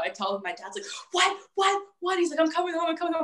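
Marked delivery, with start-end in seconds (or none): 1.1-2.2 short high yelps of disbelief; mock shocked dad. Dad hearing the news by phone, mock shock. 2.4-3.2 high, hurried announcement; mock alarmed dad. Dad at work hearing the news, mock high alarm.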